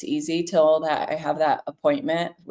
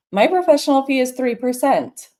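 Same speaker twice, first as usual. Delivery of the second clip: higher, louder, matter-of-fact; confident quote; 7 semitones higher, 5 dB louder than usual. Retold or imitated. Realtor quoting her fee to a seller, confident. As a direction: state the fee like it's not up for debate. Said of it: "And to be just like".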